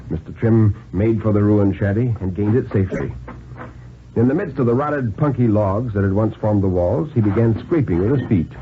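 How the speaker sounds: ordinary delivery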